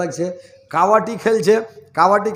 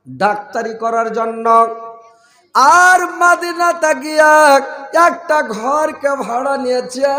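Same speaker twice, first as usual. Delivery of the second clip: loud, raised sermon chant with stretched phrase endings; preached storytelling; 5 semitones higher, 7 dB louder than usual. Real, not acted. Preacher telling the congregation about a doctor, preached storytelling. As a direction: tell the tale like a rousing preacher.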